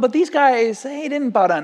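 mock tattletale accusers. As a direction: tell on them like a snitch. Chaldeans tattling to the king.

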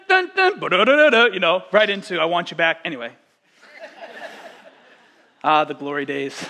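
ordinary talk, nothing marked

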